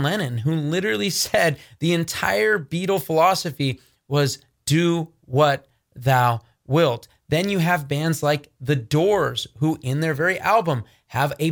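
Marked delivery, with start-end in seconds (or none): 4.7-7.1 spaced out word by word; slow, deliberate quote. Retold or imitated. Preacher quotes a band's whole philosophy, slow and deliberate. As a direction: recite the motto slowly and deliberately.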